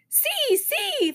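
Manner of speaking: put-on high, giggly little-kid voice; gleeful, high toddler squeal; laughing toddler. A narrator's reading. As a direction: point and giggle like a three-year-old. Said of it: "Fudge laughed".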